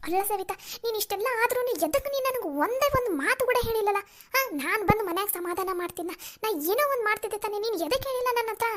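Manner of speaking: hurt pleading. Acted. Friend reproaching Savita for hiding her trouble, high and pained. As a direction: press her friend, hurt she was kept out.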